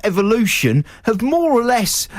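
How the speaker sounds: indignant mock-Scottish dressing-down; Scottish accent. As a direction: lecture the inventor in a put-on Scottish accent. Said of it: "say it in a Scottish accent".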